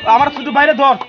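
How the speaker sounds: high, very loud command; furious bellowed command; angry order. Acted. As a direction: shout the order to seize him. Orders assistants to seize brother.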